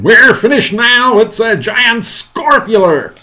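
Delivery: high, slow, panicked yell. Acted. Fire chief doomed by the monster, panicked yell. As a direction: wail it loudly as if doomed. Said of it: "yelled the head of the fire department".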